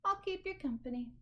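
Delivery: high, friendly sheep voice; a sheep offering to tag along; high, sweet voice; chirpy sheep. Acted.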